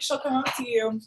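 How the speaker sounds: ordinary talk, nothing marked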